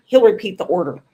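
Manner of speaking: ordinary talk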